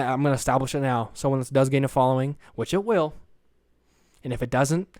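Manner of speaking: ordinary talk, nothing marked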